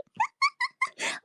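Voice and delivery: squealing high laugh. Real, not acted. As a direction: let out a squeaky giggle. Laughing at own joke.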